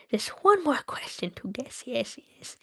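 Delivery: Gollum whispering, sly and eager; whispered Gollum. Acted. Gollum whispers to himself about the last question. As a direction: breathe it out like a creeping Gollum. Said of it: "my Gollum voice".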